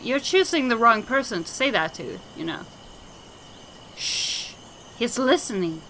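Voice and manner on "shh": a soft whispered shh; secretive unvoiced shh; hushing whisper. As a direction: hush them in a whisper. Madman hushing everyone.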